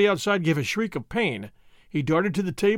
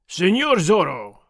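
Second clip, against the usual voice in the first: slow, mildly raised call; sergeant's cry; 4 dB louder, slower than usual. A narrator's reading. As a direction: call out slowly as the sergeant, not at full shout. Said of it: "Sergeant Gonzalez cried".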